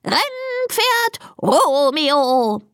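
high, drawn-out ceremonial crow; crowing rooster. A narrator's reading. Rooster Konrad presents the first contestant, crowing slowly. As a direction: announce it as a slow, high rooster. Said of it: "krähte er".

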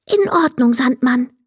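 high fairy voice agreeing; small fairy plucking up courage; brave little fairy. A narrator's reading. Fairy gathers courage for sick friend.

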